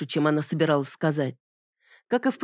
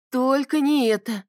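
horrified; 7 semitones higher, slower than usual. Acted. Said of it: "ужаснулась Боня".

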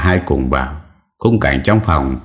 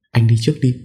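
put-on higher, timid young wife voice; frightened wife; 8 semitones higher, much faster than usual. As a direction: push him ahead, too scared to go. (giọng vợ sợ hãi, cao và rụt rè)

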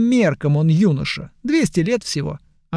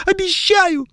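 put-on high, pleading young elf; whiny elf. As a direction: beg high and tearful.

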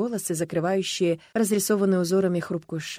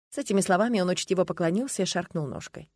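usual speaking voice

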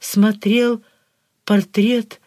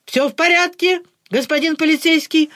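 crisp, clipped report; 7 semitones higher than usual. A narrator's reading. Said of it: "отчеканил нарисованный барон".

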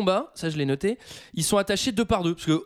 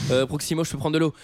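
ordinary delivery

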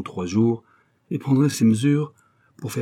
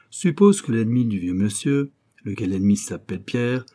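ordinary delivery, nothing marked

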